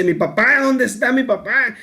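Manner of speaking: mild imitation of a child's yell; child's mock cry. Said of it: "también comienza a gritar y dice".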